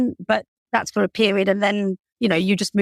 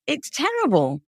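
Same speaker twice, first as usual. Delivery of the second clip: ordinary talk, nothing marked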